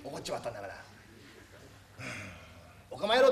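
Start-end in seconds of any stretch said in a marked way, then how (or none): 2.9-3.3 loud, high barked insult; mock angry outburst. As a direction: snap at him in fake rage. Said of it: "とか言ってみるか". (先生が怒鳴るように大声で)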